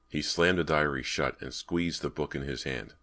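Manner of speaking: ordinary delivery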